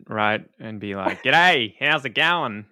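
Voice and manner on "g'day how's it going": a louder, turned-up Australian "G'day, how's it going?"; broad Australian accent. Pretend. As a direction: lay the Australian accent on thick, half joking. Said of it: "I probably need to turn it up a bit".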